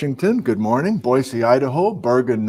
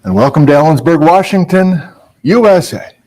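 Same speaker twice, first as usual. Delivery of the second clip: loud, upbeat broadcast welcome; announcer-style hello to everyone; show opening; 2 semitones higher, 7 dB louder, faster than usual. Acted. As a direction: greet the whole audience like opening a show. Opens livestream with a welcome.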